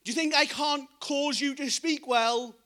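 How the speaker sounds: a God reminding Moses who made him; emphatic divine rebuke. Retold or imitated. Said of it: "God goes".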